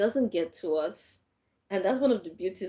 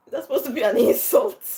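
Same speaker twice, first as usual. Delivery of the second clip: laughing disbelief; 6 semitones higher, 8 dB louder, faster than usual. Retold or imitated.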